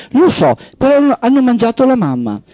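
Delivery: put-on a girl insisting ants ate her mother; insistent little girl. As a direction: push back like a small child sure she's right. Child insisting to the operator.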